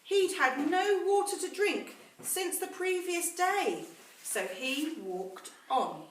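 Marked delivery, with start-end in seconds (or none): none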